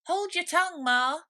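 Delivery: high, loud retort; snappish young crab. A narrator's reading. Young crab snapping at her mother, loud retort. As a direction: snap back at mother. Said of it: "a little snappishly".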